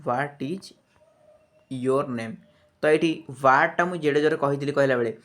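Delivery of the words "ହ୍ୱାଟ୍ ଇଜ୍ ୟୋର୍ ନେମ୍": What ଇଜ୍, long pause, then your name falling. Shown as a demonstration. Teacher demonstrating the question in parts, your name falling after a pause. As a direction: break after ଇଜ୍, let name drop. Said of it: "କମି କମି ଆସିଲା".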